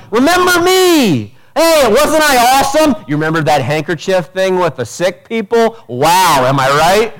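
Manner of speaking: mock boastful Paul. Preacher mocking a vain Paul to the church, high and boastful. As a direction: ham up a vain apostle fishing for praise. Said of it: "he's not going".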